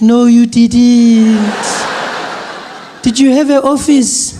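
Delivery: put-on high, loud, slow delighted old lady; grandmother. Gogo congratulating Trevor on the phone, slow and high. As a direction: gush like a proud granny. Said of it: "She's like".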